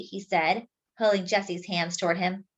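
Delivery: ordinary delivery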